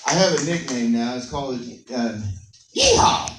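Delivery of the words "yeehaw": high shouted yeehaw; loud yeehaw. Shown as a demonstration. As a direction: holler yeehaw like a cowboy. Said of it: "we don't have to say it like that".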